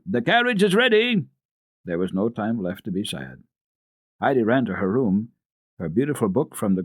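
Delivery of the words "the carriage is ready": higher, louder announcing shout; distant call. A narrator's reading. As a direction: call it out across the house. A call from below.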